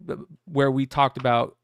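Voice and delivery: ordinary delivery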